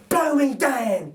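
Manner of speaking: hammed-up astonishment; surprised burglar. Narrator voices Bill finding the baby, mock surprised.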